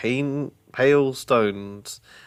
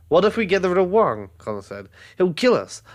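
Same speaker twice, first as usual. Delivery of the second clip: worried protest; 8 semitones higher, 4 dB louder, faster than usual. Acted. Brother fears troll will kill them.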